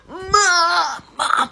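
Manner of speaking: high, strained, stretched-out call; voiceless attempted scream. Girl calling for mum while being dragged, strangled mock scream. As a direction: try to scream with no voice coming out. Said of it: "it's like I have no voice".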